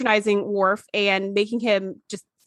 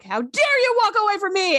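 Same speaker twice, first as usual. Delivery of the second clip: mock yelling; 10 semitones higher than usual. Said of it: "and starts yelling".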